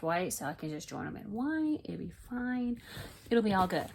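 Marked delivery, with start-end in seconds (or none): none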